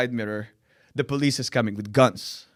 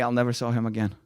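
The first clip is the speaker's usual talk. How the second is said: ordinary talk, nothing marked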